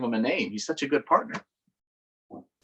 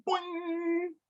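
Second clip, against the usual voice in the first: high boing sound; 5 dB quieter than usual. A narrator's reading. Leapfrog sound.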